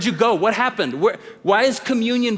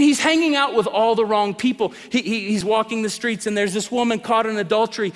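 ordinary talk, nothing marked

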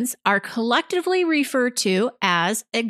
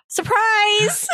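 gasp, then a high, playful exclamation; mock surprise; 12 semitones higher than usual. Host joking about an obvious definition, mock surprise. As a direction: announce it like a pretend big reveal.

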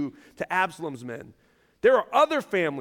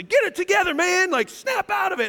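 mock shout; 11 semitones higher than usual. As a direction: shake them awake with your voice. Shaking someone, snap out.

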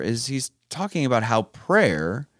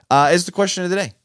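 ordinary talk

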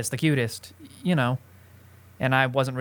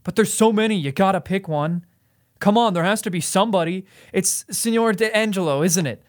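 put-on agitated boys; 6 semitones higher than usual. Retelling the boys pressing him, high and eager. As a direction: pester him like worked-up teammates. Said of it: "they got really agitated".

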